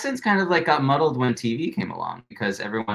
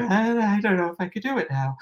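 high, hurried mock panic; flustered, high-pitched self-imitation; under pressure; 12 semitones higher than usual. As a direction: squeak it out like you're put on the spot. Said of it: "I feel, like, so much under pressure".

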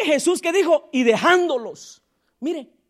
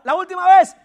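louder call; 5 semitones higher, 7 dB louder than usual. Real, not acted. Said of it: "No, hermano".

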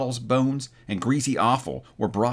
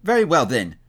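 higher, louder retort; crying out; 5 semitones higher, 5 dB louder than usual. A narrator's reading. Woman settles the argument with companions, crying out. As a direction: cut the matter short with a raised voice. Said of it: "cried the woman".

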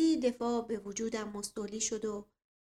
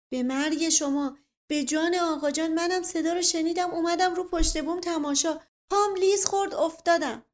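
desperate pleading; 7 semitones higher, 7 dB louder than usual. Acted. Being choked, begging desperately.

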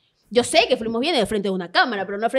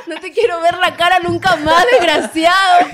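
laughing mock rant; 7 semitones higher, 9 dB louder than usual. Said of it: "le dije".

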